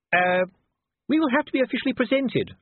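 high, urgent wifely aside; hissed reminder. A narrator's reading. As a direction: pointedly warn the husband, pitched high. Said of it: "Lady Sybil hissed".

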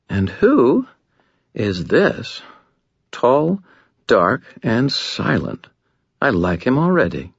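a languid, amused vampire lady toying with the room; seductive Lara voice. Acted. Lara voiced, flirting with Harry.

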